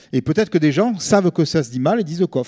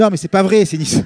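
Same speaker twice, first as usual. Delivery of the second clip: laughing; 4 semitones higher, 5 dB louder than usual. Retold or imitated. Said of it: "J'ai dit".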